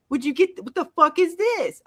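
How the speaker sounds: hammed-up confused character; imitating Boyd. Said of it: "Would you get your shit together?".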